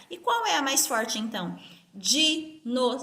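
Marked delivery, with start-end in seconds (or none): 1.9-3.0 "Dinossauro" broken into di, no, sau, ro; "Dinossauro" in spaced beats with clear gaps; separated syllables. Shown as a demonstration. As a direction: say "Dinossauro" one syllable at a time. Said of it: "Como eu separo o dinossauro?".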